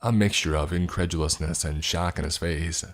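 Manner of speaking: ordinary delivery, nothing marked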